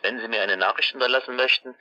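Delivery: ordinary delivery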